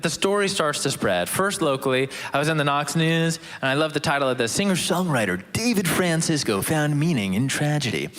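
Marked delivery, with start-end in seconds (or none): 4.5-8.1 announcer reading a headline; radio voice. Pretend. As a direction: put on your radio presenter voice. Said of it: "that's my radio voice".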